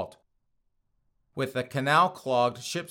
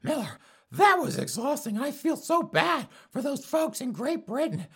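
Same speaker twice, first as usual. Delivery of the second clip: very high falsetto, building to a shrill shout; mock fretting listener; 14 semitones higher than usual. Imitating a listener wailing to Miller, in high falsetto. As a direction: whine it high and overwrought, rising to a squeal. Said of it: "if you're like".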